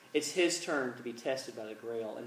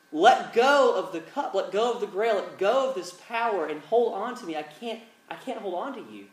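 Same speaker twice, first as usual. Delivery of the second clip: urgent plea, raised in pitch and volume; urgent pleading; 5 semitones higher, 6 dB louder than usual. Retold or imitated. Indiana begging Elsa to hold on, urgent pleading. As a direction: plead with her to let go. Acted plea at the cliff edge.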